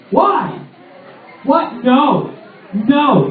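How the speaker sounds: ordinary talk, nothing marked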